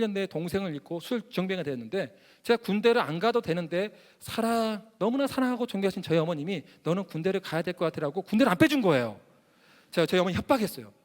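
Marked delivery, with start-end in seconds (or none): none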